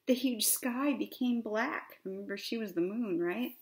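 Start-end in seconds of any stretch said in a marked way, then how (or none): none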